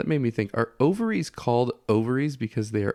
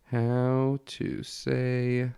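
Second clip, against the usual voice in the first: slow hushed voice; 2 semitones higher, 6 dB quieter, much slower than usual. Pretend. Slow hushed reading of search.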